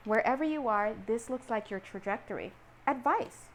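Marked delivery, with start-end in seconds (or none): none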